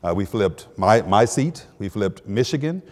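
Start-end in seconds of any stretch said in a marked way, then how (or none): none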